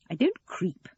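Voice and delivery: mildly indignant denial; correcting. A narrator's reading. Eleanor answering her daughter's complaint, correcting. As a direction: put her right, firmly but without raising the voice. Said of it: "Eleanor corrected her".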